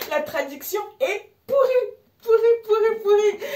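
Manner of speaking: high, loud squeal of mirth; high-pitched, cracking-up outburst; helpless hilarity. Real, not acted. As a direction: squeal the repeats, nearly crying with laughter. Cracking up over terrible translations.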